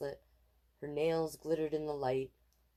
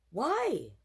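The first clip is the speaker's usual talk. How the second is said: put-on child asking why; 8 semitones higher, 5 dB louder than usual. A narrator's reading. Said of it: "Casey asked".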